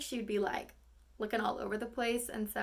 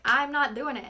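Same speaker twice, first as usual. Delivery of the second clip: firm refusal; 3 semitones higher, 8 dB louder than usual. Retold or imitated. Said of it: "that's when she kind of is like".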